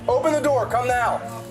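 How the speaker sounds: high, loud command; barking police commands. Real, not acted. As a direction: bark the order. Police ordering suspect out.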